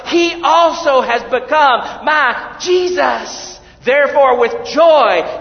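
loud, high, ringing reading; proclaimed scripture. Acted. Preacher reading Isaiah twelve to the congregation, ringing proclamation. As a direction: declare the verse to the whole hall.

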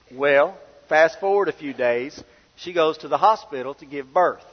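ordinary delivery

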